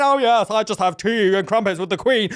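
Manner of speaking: hammed-up TV-Brit accent; mock posh British. Mocking actors who fake it, overly proper British accent. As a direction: parody a proper English accent. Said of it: "stop standing around going".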